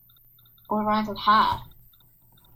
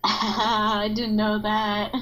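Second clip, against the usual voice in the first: said through real laughter; laughing; much slower than usual. Real, not acted. Sister hears her animal noises crash the edit, laughing. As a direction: laugh while getting the words out. Amused reaction to sister's complaint.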